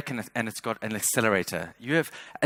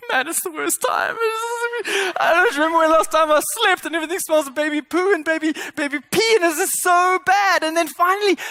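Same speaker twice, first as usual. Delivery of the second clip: exhausted rant; 6 dB louder than usual. Retold or imitated. Worn-out new father complaining.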